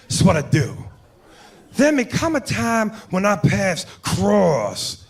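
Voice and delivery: slow, drawn-out movie cop impression; Al Pacino. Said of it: "Pacino's sitting there with De Niro".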